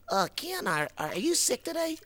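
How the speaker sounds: high silly puppet voice. Acted. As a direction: ask like a goofy puppet.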